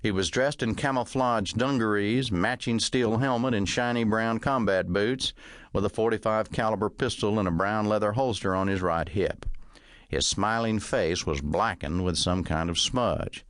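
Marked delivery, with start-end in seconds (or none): none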